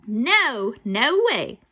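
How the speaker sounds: loud, emphatic, swooping refusal; firm refusal. Acted. Boy digs in against the ghost, loud refusal. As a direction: refuse loudly, hitting each no.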